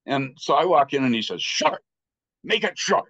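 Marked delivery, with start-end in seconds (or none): 1.4-3.0 curt command, pitched much higher, a touch louder; fierce lama. Retold or imitated. Lama ordering the nervous student to be brief, curt and high. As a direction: bark it out like a stern teacher. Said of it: "he could be really fierce".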